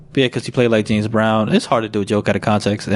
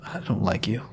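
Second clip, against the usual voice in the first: breathy mock whisper; 10 dB quieter than usual. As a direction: whisper it like a hurt aside. Mock hurt aside.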